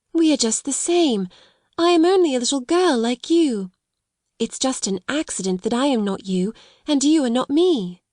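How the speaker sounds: high, earnest comfort; tender young girl. A narrator's reading. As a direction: share a kind idea with a friend. Sarah comforting Becky.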